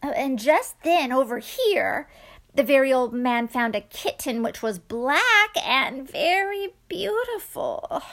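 plain reading voice

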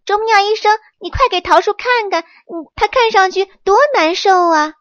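high, loud, fast urging; urgent plea. Acted. Monkey urging the woodpecker doctor to treat the tree. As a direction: hurry the doctor along, worried.

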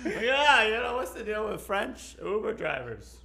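high-pitched comic impression of a stand-up; high, hammed-up comedian voice; Seinfeld. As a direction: set up an observational bit in a high, pushed voice. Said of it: "That's my Seinfeld impression".

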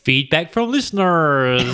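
slow, deliberate accent demo; S2's accent. Shown as a demonstration. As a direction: say it slowly in the accent. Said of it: "but it's more like this".

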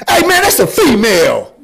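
light imitation of Craig, laughing; lightly voiced Craig, half laughing; Craig impression. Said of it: "I do it like Craig".

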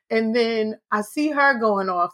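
usual speaking voice

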